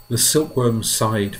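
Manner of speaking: ordinary delivery, nothing marked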